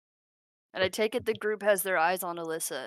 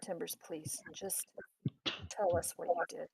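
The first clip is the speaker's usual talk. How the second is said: hushed, halting appeal; quiet plea; 10 dB quieter than usual. Acted. Alyssa turns to Timbers for the truth, pleading softly. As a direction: plead very softly, pausing between phrases.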